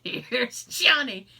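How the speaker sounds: slow, laughing film-villain catchphrase; Jack Nicholson impression. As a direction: draw out the catchphrase through a laugh. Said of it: "I'm Jack Nicholson".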